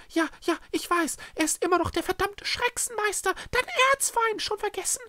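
very high character voice; high-pitched impatient reminder; exasperated Echo. Acted. Echo reminds Schreckse of her arch-enemy, exasperated.

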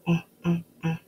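"ah" as short separate A sounds; isolated vowel sound. Shown as a demonstration. Teacher sounding out arrow, "ah" short and separate. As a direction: sound out short A three times, evenly. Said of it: "I hear the letter A".

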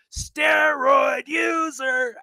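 high, drawn-out stadium heckle; retold full-voiced taunt, syllables dragged long; top-of-lungs crowd yell. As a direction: stretch it out like a jeering chant from the stands. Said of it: "as loud as I could".

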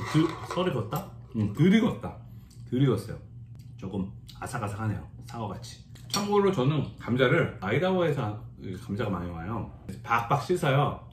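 talking between bites; chewing while talking. Real, not acted. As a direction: mouth partly full of food. Seen 2: Just ate potato; jaw and cheeks work between words, chopsticks ready.

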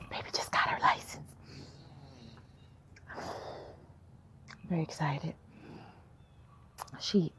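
excited secret whisper. Real, not acted. Mom confiding after the test.

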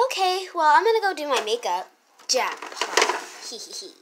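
ordinary talk, nothing marked

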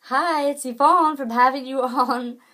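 bright, high, loud cheery hello; a big cheerful video intro voice, imitating Yvonne; cheerful greeting. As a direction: sing out the greeting like a cheerful vlogger. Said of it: "I just love her cheerful voice".

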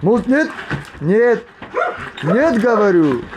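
firm shout. Real, not acted. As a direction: shout the dog off. Stopping the dogs fighting.

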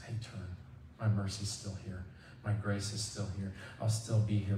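soft, low reassurance; gentle call. Acted. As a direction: call them back very softly and low. God gently calling people back.

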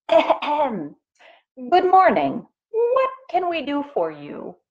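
high-pitched chief frog clearing his throat. A narrator's reading. Chief frog greets the strange pig, high and formal. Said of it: "the chief frog spoke up".

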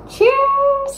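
high, bright toast; excited cheer. Real, not acted. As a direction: call out cheers happily. Toasting with friends at dinner.

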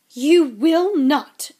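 loud, high, drawn-out refusal; snapped. Acted. Mother forbidding her son to go to bed, snapping. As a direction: slam the door on it, slow and loud. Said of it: "snapped Missus Weasley".

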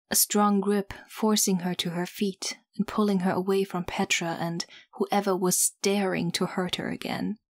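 plain reading voice

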